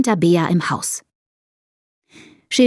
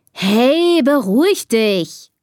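drawn-out, higher calm-down call; 4 semitones higher, much slower than usual. A narrator's reading. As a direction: call down to soothe an upset sister. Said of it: "ruft Bea".

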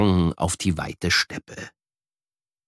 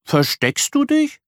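put-on high child voice asking; curious little boy, higher; little brother. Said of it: "fragt Luca weiter".

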